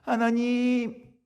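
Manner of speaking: a long, drawn-out, high cry of "하나님!"; 하나님을 길게 높이 외치는 기도; loud cry to God. Retold or imitated. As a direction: call out to God at full voice, stretched slow. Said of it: "또 더 큰 소리를 기도합니다".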